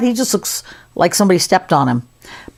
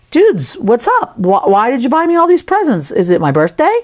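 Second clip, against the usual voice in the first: high-pitched, playful excitement; hammed-up eager questioning, settling at the end; excited, slightly quizzical; 6 semitones higher than usual. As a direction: act thrilled and puzzled by a surprise. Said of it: "get him really excited and maybe slightly quizzical".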